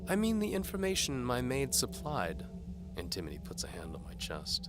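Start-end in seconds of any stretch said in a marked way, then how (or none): 0.1-2.5 higher, louder teasing lady voice; seductive noblewoman. A narrator's reading. Antimony dares the knight to follow. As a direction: tease the knight like a bold noblewoman.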